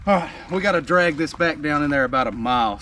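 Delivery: ordinary delivery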